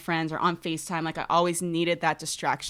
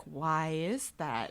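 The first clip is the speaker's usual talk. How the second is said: quiet wondering; 2 semitones lower, 8 dB quieter, much slower than usual. Retold or imitated. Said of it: "And I'm like".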